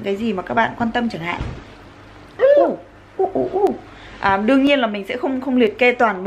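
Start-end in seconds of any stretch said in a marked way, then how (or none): 2.6-3.7 into a fan. Shown as a demonstration. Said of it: "Nói trước quạt để cho nó rung rung á".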